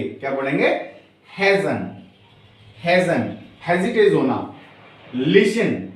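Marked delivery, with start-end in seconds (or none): none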